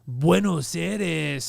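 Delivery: Latin American Spanish, slow and drawn out; mock Mexican Spanish. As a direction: stretch Buenos Aires the Latin way. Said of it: "They're like".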